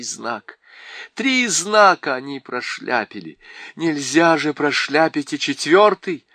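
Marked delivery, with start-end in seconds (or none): none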